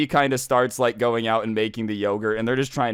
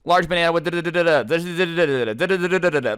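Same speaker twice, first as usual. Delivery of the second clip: fast, higher-pitched nonsense patter; rattling off; 5 semitones higher, faster than usual. Retold or imitated. Mom firing off yogurt orders at workers, rattled off fast. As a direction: rattle it off in a hurried imitation. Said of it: "she just starts rattling off all these different froyo orders".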